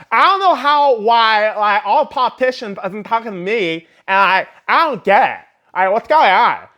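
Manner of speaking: high, loud mock [name] voice; horrendous [name] impersonation. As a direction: ham up a clueless celebrity whine. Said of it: "that was a horrendous impersonation of [name]".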